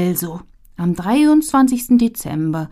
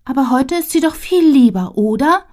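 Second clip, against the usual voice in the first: put-on higher, pleading little girl; hopeful child; 7 semitones higher, 3 dB louder than usual. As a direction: ask hopefully like a small child. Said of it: "fragt Ziff".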